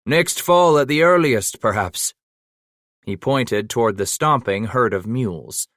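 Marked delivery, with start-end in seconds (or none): 0.1-2.1 lightly voiced Scottish leader addressing the crowd; a hint of a commanding officer, raised. A narrator's reading. Campbell addresses the men.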